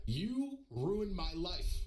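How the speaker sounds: very slow, drawn-out retold accusation; dramatic quote. Stranger confronting the streamer in the story, slow and dramatic. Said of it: "This guy goes like this".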